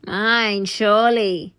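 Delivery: contradicting. A narrator's reading.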